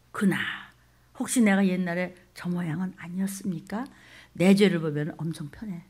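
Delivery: usual speaking voice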